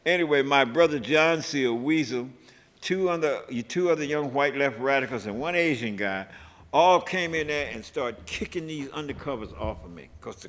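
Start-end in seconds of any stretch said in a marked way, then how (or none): none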